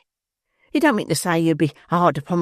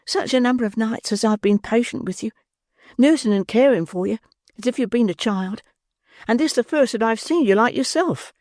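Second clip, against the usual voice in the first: higher, tender woman's voice; 7 semitones higher than usual. A narrator's reading. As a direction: soften it with sweetness. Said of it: "with the touch of woman's tenderness".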